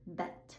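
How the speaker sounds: bet ending in a crisp breathy T; unvoiced final T. Shown as a demonstration. Teacher modelling the unvoiced ending of bet. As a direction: clip the T in bet with no voice. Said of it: "then the unvoiced version".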